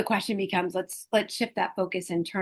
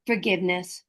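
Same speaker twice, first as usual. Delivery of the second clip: ordinary talk, nothing marked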